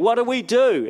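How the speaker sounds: high, loud panicked cry. Retold or imitated. Israelites at the Red Sea crying to Moses, panicked. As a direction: voice the trapped Israelites calling out in fear. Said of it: "And they're crying out".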